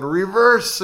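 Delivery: high, loud shouted command; re-enacted holler from the gallery; yell. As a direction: call it out sharply over the crowd. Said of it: "and I yelled".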